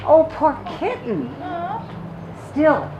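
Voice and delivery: normal talking voice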